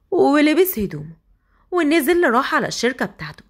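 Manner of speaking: ordinary delivery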